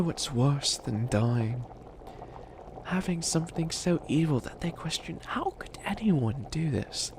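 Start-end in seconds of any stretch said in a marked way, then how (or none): 5.2-7.1 hushed, whispered mock dismay; whispered horror. Villain imitating his victims' hushed horror. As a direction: breathe it like an appalled victim.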